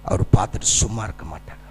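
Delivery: low, hushed words of comfort; tender preaching. Real, not acted. Tender assurance of God's compassion.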